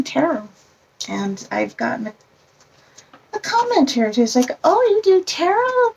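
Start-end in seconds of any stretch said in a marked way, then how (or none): 4.6-5.9 high, loud questioning imitation; exaggerated "oh, really?" reaction; mock surprised coworker. Quoting a coworker's surprised comment.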